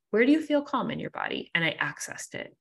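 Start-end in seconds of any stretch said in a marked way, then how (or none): 0.1-1.5 higher and quicker, gently coaching herself; self-guiding question. Retold or imitated. Guiding herself through anxiety at night, lightly coaching tone. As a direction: lift the voice and ask it like a guide. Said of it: "I immediately went into".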